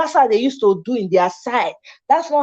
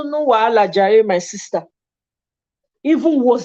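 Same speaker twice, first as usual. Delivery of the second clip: ordinary delivery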